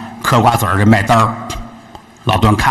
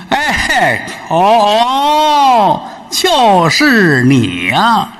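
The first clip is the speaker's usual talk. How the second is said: scornful drawl; 8 semitones higher, much slower than usual. Acted. Drawn-out scornful recognition.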